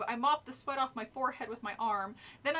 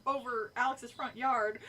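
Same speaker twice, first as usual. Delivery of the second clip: usual speaking voice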